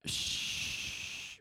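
drawn-out soft shh; a long, quiet, voiceless shush; long shushing hiss. Real, not acted. Reacting to the horses explanation, a long soft shush. Long wordless hush before "What?.

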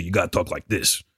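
sleazy voice dropping low; super sleazy. Shown as a demonstration. Showing his voice for sleazy stuff, sleazy. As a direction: sink into a sleazy low register. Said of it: "if it's, like, something super sleazy".

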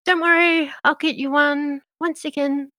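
a chirpy cook promising another bowl; Meadow voice. Acted. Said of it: "She says".

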